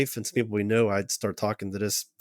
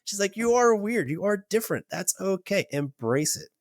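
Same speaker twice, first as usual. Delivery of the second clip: higher, insistent pep talk; emphatic encouragement; 10 semitones higher than usual. Retold or imitated. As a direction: push him to own his difference. Therapist encouraging him emphatically.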